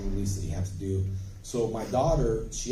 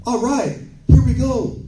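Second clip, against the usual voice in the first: much higher and louder, hammed-up hype; mock overacting actor; 14 semitones higher, 13 dB louder than usual. Mocking an overeager actor psyching up, hammed-up loud. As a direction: ham it up like a showy actor before a take.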